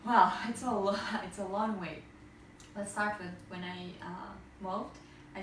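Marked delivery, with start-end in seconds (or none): none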